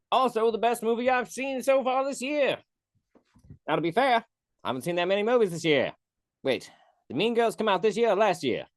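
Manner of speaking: put-on lightly done American-style British accent. Reviewing Dune in an unplanned British accent. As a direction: slip into a casual British accent. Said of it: "talking in this voice".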